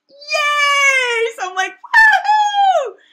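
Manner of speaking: high, loud whooping cheer; hammed-up victory shout; psyched cheer. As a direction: whoop it out, thrilled to be let in. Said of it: "psyched, right?".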